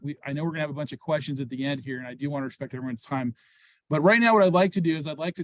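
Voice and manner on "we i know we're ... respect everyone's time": rushed, quieter explanation; quick, apologetic rush through the words; talking really fast. Real, not acted. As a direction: hurry through it to save everyone's time. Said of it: "I'm talking really fast".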